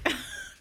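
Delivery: breathy nervous giggle; nervous laughter. Real, not acted. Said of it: "That's why I'm laughing, 'cause I'm nervous".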